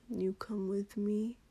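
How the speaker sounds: slow, pouty whisper; pouting whisper. Acted. Lover asking his partner to come along, pouting whisper. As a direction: ask it like a sulky child, barely voiced. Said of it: "He all but pouted".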